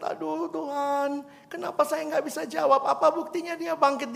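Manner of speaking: mild weeping to God; tearful plea. Retold or imitated. Girl tearfully asks God why she couldn't answer. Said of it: "Dia nangis terseduh-seduh".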